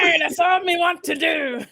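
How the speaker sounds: laughing pirate talk. Pretend. Host answering cohost in playful pirate voice. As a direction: giggle through a high mock pirate line. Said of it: "I want you to talk like a pirate".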